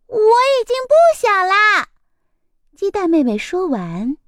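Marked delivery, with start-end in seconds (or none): 0.1-1.9 put-on high, loud childish protest; indignant little chick. A narrator's reading. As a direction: insist you're a big girl now.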